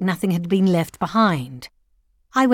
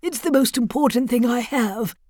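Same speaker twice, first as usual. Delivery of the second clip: lightly voiced grieving old widower; 2 semitones higher than usual. A narrator's reading. Voices the old father-in-law.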